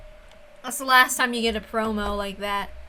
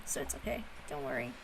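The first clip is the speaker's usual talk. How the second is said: hushed reassurance; 9 semitones lower, 16 dB quieter, faster than usual. Real, not acted. Reassuring chat, fading to whisper.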